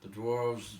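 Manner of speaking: usual speaking voice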